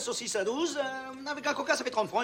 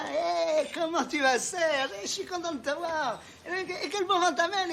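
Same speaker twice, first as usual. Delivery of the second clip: imitating a gushing Robert; 7 semitones higher, slower than usual. Serge voices Robert greeting him.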